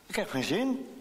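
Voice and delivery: a touch of angry pouting; cross. Acted. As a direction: push the refusal back at mother, annoyed. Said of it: "reageert Tineke boos".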